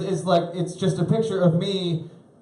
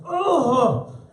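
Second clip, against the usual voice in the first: mock surprise; 10 semitones higher than usual. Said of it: "I was like".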